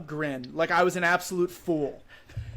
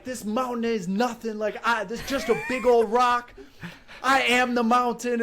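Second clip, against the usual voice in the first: retold defiant boast, pitched higher; trash talk; 5 semitones higher than usual. Runner out loud to the mountain he summited, trash-talking. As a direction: crow over a beaten foe, voice raised in pitch. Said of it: "I'm out loud talking trash".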